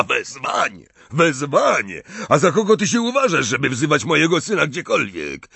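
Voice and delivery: loud, high, escalating indignation; heated character outburst; outraged. A narrator's reading. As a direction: repeat in disbelief, then rush into fury. Gaunt's outraged retort to a summons.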